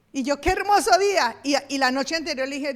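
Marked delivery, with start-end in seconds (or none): 0.4-1.4 high, loud fake delight; fake cheer. Shown as a demonstration. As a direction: gush over the rainy day. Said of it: "Estaba lloviendo".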